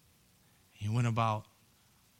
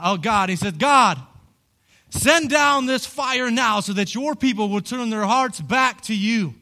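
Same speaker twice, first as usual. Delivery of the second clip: loud, high preaching cry; preaching at full force; 8 semitones higher, 7 dB louder than usual. Acted. Preacher voicing Elijah calling on God, loud. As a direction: call the fire down with full force.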